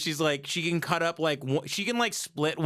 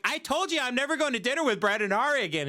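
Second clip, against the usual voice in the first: higher, fast mock protest; protesting husband; 7 semitones higher, much faster than usual. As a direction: rattle out an exasperated objection. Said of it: "I'm like".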